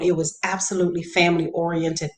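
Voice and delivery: ordinary talk, nothing marked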